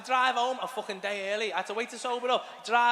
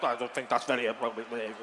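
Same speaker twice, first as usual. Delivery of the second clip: lower, prissy put-on disapproving voice; prim Brenda; 8 semitones lower than usual. Brenda scolds him while storming away, prim mock disapproval. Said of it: "Brenda storming away like".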